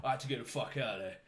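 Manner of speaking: fast, lightly done Cockney voice; Danny Dyer impression. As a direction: rattle it off like a scared geezer. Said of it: "He, he would do that".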